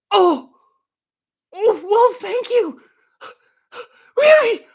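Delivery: very high, loud put-on surprise; mock amazement. Host reacting to bond king's obvious news, mock amazed. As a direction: squeal with feigned gratitude and astonishment.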